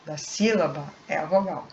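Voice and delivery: normal talking voice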